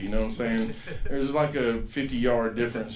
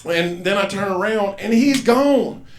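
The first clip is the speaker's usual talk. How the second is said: higher, louder, slowing on gone; building disbelief; 7 semitones higher, 8 dB louder, slower than usual. Real, not acted. Telling the crowd the boy vanished, stunned. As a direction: let the shock land on gone.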